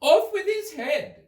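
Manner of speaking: very high, slow shrieking order; furious Queen. A narrator's reading. Queen orders the cat beheaded, very high and slow. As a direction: bark the sentence without a thought.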